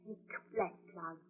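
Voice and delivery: a hushed, uneasy warning; ominous hush. Acted. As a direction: let the words sink low and slow. Wife foresees a coming storm.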